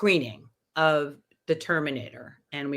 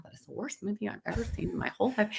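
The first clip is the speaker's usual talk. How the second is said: fast, hushed verdict; hushed blurt; 4 semitones higher, 8 dB quieter, faster than usual. Retold or imitated. Whispering to his manager after the screening, hushed and fast. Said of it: "And I go".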